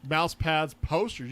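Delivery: usual speaking voice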